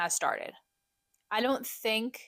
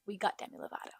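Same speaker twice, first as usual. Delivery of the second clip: a much softer, breathy aside; hushed excitement; 7 dB quieter, faster than usual. Real, not acted. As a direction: let it slip out under your breath. Excited concert news, hushed.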